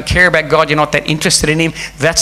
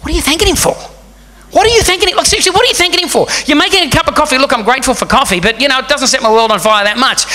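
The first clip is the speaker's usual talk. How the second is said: higher exasperated inner voice; annoyed; 10 semitones higher than usual. Retold or imitated. Preacher recalling his irritation at the coworker. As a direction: roll your eyes at him. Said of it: "I used to think".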